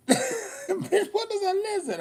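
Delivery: high squealing laugh on the words. Pretend. Teasing her friend about lizard, squealing laughter.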